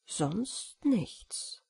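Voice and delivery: breathy hushed whisper. A narrator's reading. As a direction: whisper it into the silence. Hushed listening in the dark.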